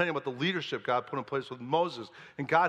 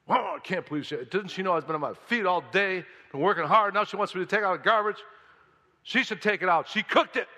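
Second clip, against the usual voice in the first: rising, louder, huffy complaint; indignant grumbling; 4 semitones higher, 4 dB louder than usual. Pretend. Husband grumbles at his wife about the garbage, indignant. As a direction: build a put-upon husband's rant. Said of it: "complaining, grumbling".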